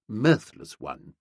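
plain reading voice

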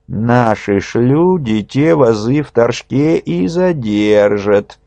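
slow, sly, scheming voice; sly schemer. A narrator's reading. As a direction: spell out the plot slyly. Schemer lays out plot.

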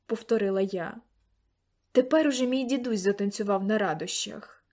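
ordinary delivery, nothing marked

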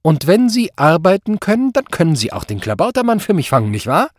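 loud, high opening, pushing question; menacing pressure. A narrator's reading. Superior demands the commissioner catch the culprit, pressing. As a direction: corner him into agreeing.

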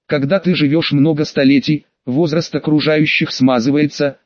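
usual speaking voice

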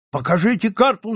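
high, urgent demand; crowd shout. A narrator's reading. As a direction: cry out from the onlookers. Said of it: "закричали голоса".

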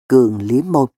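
ordinary delivery

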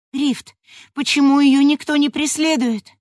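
high, loud anxious question; urgent worried question; alarmed young woman. Acted. Elda alarmed asks Rift.

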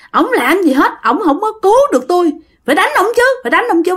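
put-on high-pitched angry young man speaking through a woman; the drowned son's ghost, blaming his father through a possessed woman. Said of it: "Cổ nói vậy nè".

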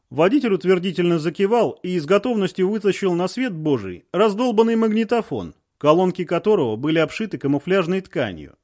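ordinary delivery

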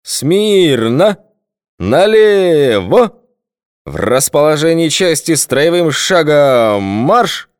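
slow, booming drill commands, high and loud; menacing bark. A narrator's reading. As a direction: bark the parade-ground orders. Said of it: "вдруг рявкнул грозно".

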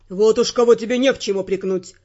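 low male voice, stern; stern Ferrant. Acted. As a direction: turn it back on her.

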